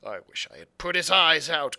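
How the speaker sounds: a hint of a snarling old blind man; Pew crying out. A narrator's reading. Blind Pew crying out at the inn folk in anger. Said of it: "cried the blind man".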